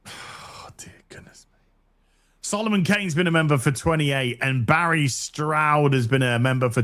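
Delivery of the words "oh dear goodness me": breathy whisper of mock awe; whispered aside. Real, not acted. Host whispers in awe at a long membership, soft whisper. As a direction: murmur it under your breath, amazed.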